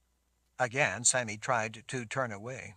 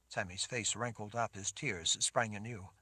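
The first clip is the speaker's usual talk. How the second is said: plain reading voice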